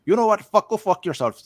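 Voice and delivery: put-on high, loud accented outburst; retold angry scammer. Scam caller losing patience with his target, mock-angry accent. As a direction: explode in a mimicked caller's accent. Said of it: "then the guy goes".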